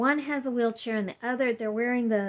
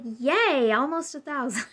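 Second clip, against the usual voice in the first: louder, happy little yay; mock cheer; 4 semitones higher, 5 dB louder than usual. Patient cheering her own alarming blood sugar. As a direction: cheer the number like it's a high score. Clueless cheer at dangerous number.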